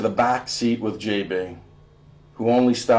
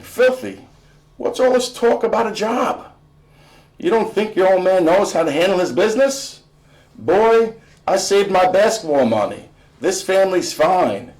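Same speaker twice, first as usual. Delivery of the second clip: dad defensive; 7 semitones higher than usual. Acted. Dad defensive, much higher.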